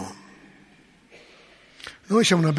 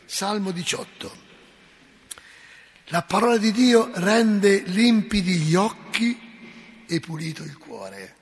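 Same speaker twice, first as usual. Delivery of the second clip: usual speaking voice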